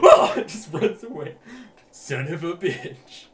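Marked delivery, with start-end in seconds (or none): 0.0-0.3 shrill mock shriek, very high; high scream. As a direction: squeal it out in alarm. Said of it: "She goes".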